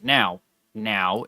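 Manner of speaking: ordinary delivery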